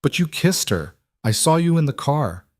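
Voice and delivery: put-on a child catching dad out; teasing little girl. A narrator's reading. Daughter catches father out.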